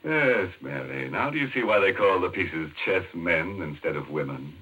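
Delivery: usual speaking voice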